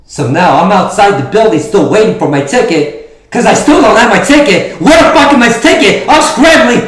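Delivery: frantic high shouting promo; wrestling promo. Acted. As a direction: yell it like a desperate wrestler. Wrestling promo, shouting outside arena.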